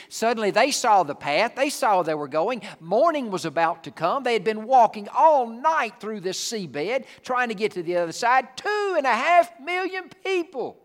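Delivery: booming the crossing to the church; rising preaching. Real, not acted. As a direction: drive the story to its peak. Preacher building the story.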